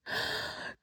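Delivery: sharp unvoiced gasp; shocked gasp. A narrator's reading. Girls gasp at the broken table, shocked. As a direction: gasp in horror. Girls see Aslan gone.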